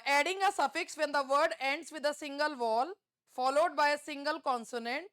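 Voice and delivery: reads the exam question out very slowly; deliberate test-question read-aloud. Acted. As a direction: spell out each word of the question for the class.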